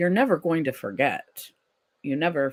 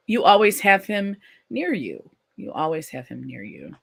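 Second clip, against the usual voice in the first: normal talking voice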